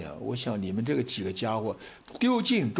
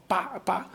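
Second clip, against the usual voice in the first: a raised, higher-pitched "叭叭" like a car horn; imitated loud horn; 6 semitones higher than usual. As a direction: honk it out like a blaring taxi. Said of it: "都按得很响哦". (模仿刺耳的喇叭声)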